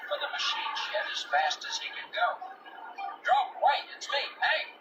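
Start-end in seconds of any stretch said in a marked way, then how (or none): 3.2-4.7 calling after a fleeing dog, higher. Acted. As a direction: shout to stop him running off. Hank calling after Drover.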